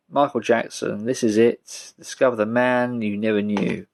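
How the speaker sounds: usual speaking voice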